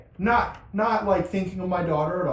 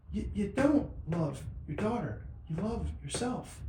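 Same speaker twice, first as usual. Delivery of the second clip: higher, softer divine rebuke, slow; soft voice of God; 5 semitones higher, 7 dB quieter than usual. Retold or imitated. God telling the father he loves himself, softly. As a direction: quietly deliver God's correction. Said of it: "And God says".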